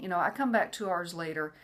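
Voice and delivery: ordinary talk, nothing marked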